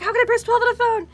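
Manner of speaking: frustrated caller losing patience; exasperated caller. Retold or imitated. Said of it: "and you're like".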